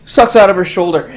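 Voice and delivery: ordinary talk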